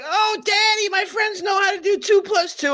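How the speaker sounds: put-on high, shouted kid whine; whiny child voice. Father retelling his whining child's plea, high squeaky kid voice. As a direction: squeal it like a pestering little kid. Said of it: "you'd hear 'em be like".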